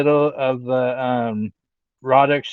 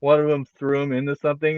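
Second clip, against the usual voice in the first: ordinary delivery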